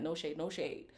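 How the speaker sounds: ordinary talk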